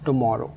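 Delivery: "tomorrow" with the weight on "mor"; stress on O. Shown as a demonstration. Teacher repeats "tomorrow", stress on MOR. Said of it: "ओ पर stress है".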